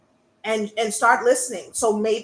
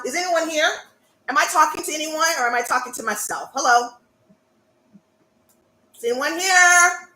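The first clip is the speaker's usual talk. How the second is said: mock calling out; 6 semitones higher, faster than usual. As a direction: pretend you're shouting into an empty hall. Calls out to quiet audience.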